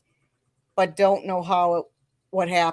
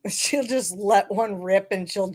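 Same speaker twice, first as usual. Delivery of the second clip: normal talking voice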